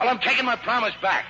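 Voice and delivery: stubborn outburst at the prim lady; defiant retort. Acted. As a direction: throw the promise back at her. He refuses during quarrel.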